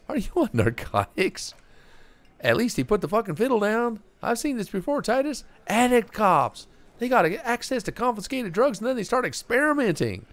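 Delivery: high, pushy barman voice; gruff bartender voice. Acted. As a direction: sneer at the cop from behind the bar. Reads game characters aloud.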